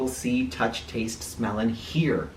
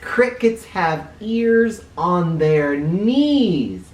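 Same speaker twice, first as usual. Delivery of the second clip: slow and louder, lean on knees; slow emphatic fact; 5 semitones higher, 5 dB louder, much slower than usual. Acted. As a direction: spell it out like a big secret. Repeating the fact for effect.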